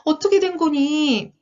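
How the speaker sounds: high-pitched, startled storybook voice; startled question. Acted. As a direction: ask it in wide-eyed surprise. Story figure asks in dismay.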